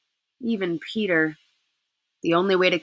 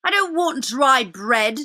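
sulky; 6 semitones higher, slower than usual. Acted. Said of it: "said Edmund sulkily".

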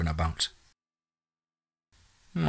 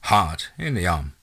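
ordinary delivery

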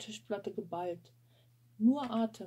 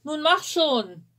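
loud, slow, impatient bellow; furious chef; 7 semitones higher, 13 dB louder, slower than usual. Acted. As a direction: yell at her to hurry.

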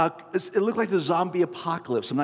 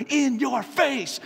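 slow, high gloating taunt; mock taunt; 11 semitones higher, 3 dB louder, much slower than usual. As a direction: drag out the gloat at the rival shopper. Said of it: "I look at her, and I go".

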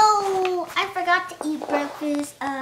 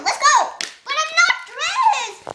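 high, loud panicky cries; frantic about being late; panicked hurry; 10 semitones higher, 5 dB louder than usual. Acted.

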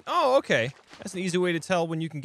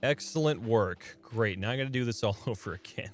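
usual speaking voice

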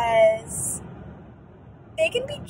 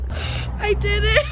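laughing crying; 4 dB louder, much slower than usual. Real, not acted. After nose piercing.

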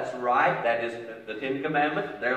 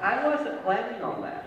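ordinary talk, nothing marked

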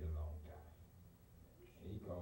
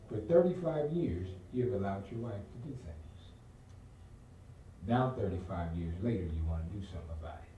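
louder, driving sermon cadence; fiery preaching; 10 dB louder than usual. Acted. Preacher telling the congregation what he told the pastor, at full force.

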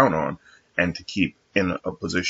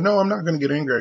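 much higher mock voice, protesting; imitating coworker; 10 semitones higher, faster than usual. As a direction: play her defensive denial. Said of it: "she's like".

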